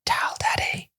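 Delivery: put-on soft high whisper of a little girl; child's whisper. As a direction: breathe it out like a small child at the bedside. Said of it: "really quietly".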